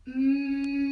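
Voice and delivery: loud steady closed-lip hum; humming bee sound. Shown as a demonstration. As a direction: buzz like a bee through closed lips. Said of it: "you're making a humming sound like a bee from your mouth".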